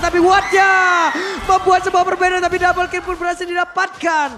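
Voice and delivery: loud, high chanting hype; esports caster. Real, not acted. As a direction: chant the name, shouting the play. Caster chants Watt's name.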